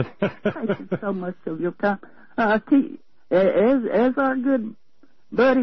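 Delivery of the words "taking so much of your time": soft laugh through the words; softer, laughing it off. Real, not acted. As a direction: apologize while chuckling. Laughing apology to host.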